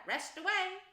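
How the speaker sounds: high, loud indulgent go-ahead; good-natured. A narrator's reading. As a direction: cheerfully grant permission to rest. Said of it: "said the good-natured Rat".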